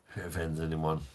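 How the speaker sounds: ordinary talk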